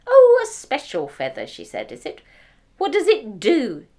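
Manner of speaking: high, loud mocking echo; sarcastic wife. The wife mocks her husband's feather, high and loud. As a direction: sneer at his silly gift.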